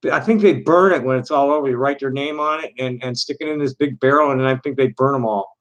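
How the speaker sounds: ordinary talk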